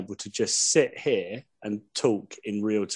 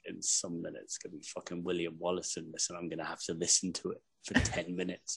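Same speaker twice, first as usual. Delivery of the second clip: low, much softer grumble under the breath; muttered wife complaint; 7 semitones lower, 12 dB quieter than usual. Retold or imitated. Wife dreading the film's Wallace scene, muttered grumble. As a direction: mutter it as a weary aside. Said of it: "my wife just being like".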